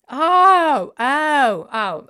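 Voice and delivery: loud, high startled oh; shocked cry. Real, not acted. Streamer yelps at a banned word in chat, shocked. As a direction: gasp out in alarm at the word.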